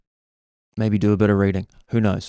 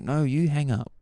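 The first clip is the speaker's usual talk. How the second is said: higher, softer teasing reply; playful mock coyness; 5 semitones higher, 4 dB quieter than usual. Lady plays hang-up game with caller, high and coy. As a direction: answer back high and lightly, cooing.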